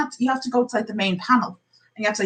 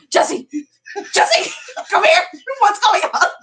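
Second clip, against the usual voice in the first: retold yell; 8 semitones higher, slower than usual. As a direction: shout across the corridor. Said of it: "My quick assessment was going".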